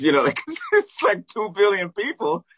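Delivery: laughing through the words, high; can't stop laughing as he tells it; amused laughing disbelief. Real, not acted. Laughing while telling.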